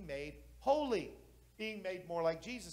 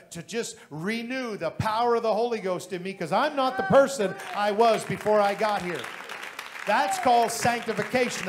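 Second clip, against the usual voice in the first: building preaching; 11 dB louder than usual. Acted.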